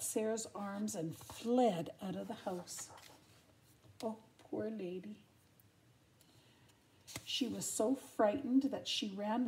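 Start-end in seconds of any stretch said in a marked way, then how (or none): none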